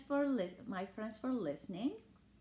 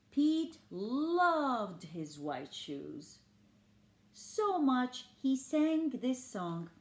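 lively picture-book storytelling; reading to children; 4 semitones higher, 4 dB louder than usual. A narrator's reading. Reading Pete the Cat to listening children, lively storybook voice. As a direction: read it like bedtime story time for kids.

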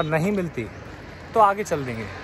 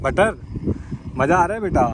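higher and louder, fondly asking the dog; doting pet talk; 4 semitones higher, 3 dB louder than usual. Real, not acted. Owner lovingly asks his dog Butter on the walk, doting pet talk. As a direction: coo at the dog like a proud parent.

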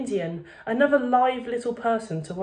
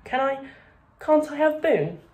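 small voice; 7 semitones higher, 4 dB louder than usual. A narrator's reading. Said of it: "asked Patrick in a small voice".

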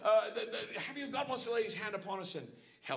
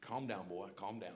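very low, soft, steadying; hushed, low correction; inner calming voice; 11 semitones lower, 7 dB quieter than usual. Acted. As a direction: quietly talk someone down from frustration. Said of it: "Holy Ghost convicted me".

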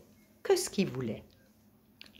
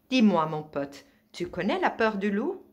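lightly voiced wolf, louder; loup menaçant; 6 semitones higher, 7 dB louder than usual. A narrator's reading. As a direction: challenge the caught rabbit loudly. Wolf grabs and taunts the rabbit. (loup légèrement joué, voix plus forte)

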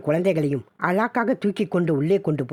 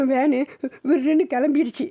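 crying out; 9 semitones higher than usual. Acted. Boy tells mother the van sped off, high tearful. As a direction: end the story in a rising wail. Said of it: "தேம்பி தேம்பி".

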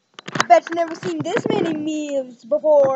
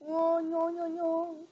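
quiet; 10 dB quieter, slower than usual. Acted. Quiet muttered refusal.

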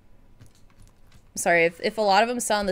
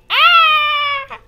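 a short, very high, loud shriek; high delighted shriek; 9 dB louder than usual. Acted. Friend shrieking over May's return. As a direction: squeal with sheer glee.

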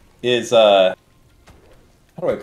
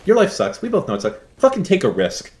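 blunt mock sales pitch, pitched up; edgy pitch; 7 semitones higher than usual. Streamer goading one viewer to gamble, edgy and blunt. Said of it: "a little bit edgy".

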